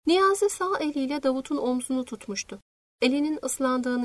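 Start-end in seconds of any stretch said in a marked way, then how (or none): none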